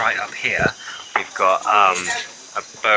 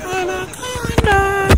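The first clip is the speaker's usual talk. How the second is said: excited drawn-out call; 3 dB louder, much slower than usual. Real, not acted. Shouting out a sighting.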